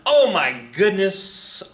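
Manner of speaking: slow, high, drawn-out exclamation; exaggerated dismay. Real, not acted. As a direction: stretch it out in mock shock. Reaction to Jackson's record.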